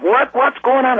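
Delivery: high-pitched mimicked question; imitated startled parents. Mom and stepdad roused from bed, imitated startled question.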